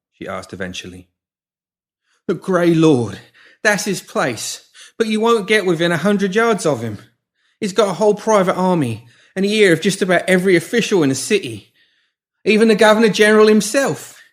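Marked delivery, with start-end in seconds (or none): 2.3-14.2 much higher, louder boast through fear; frightened but proud. Acted. Pinky boasting of Ward to the woman, proud and scared. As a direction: brag about your boss despite the pain. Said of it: "a touch of pride creeping into his voice".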